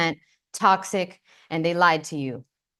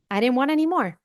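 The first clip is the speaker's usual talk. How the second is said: ordinary talk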